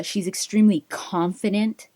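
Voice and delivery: usual speaking voice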